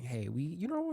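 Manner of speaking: normal talking voice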